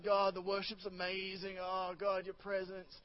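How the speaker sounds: soft, breathy rapture, imitating worshippers; mock worshipful hush. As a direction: hush it like someone lost in worship.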